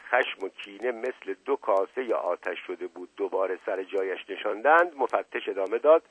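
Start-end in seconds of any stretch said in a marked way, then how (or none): none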